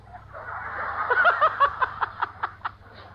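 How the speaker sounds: a high, airy burst of laughter; high breathy laugh. Real, not acted. Preacher laughing at his own story, high airy laugh. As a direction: laugh lightly at the irony.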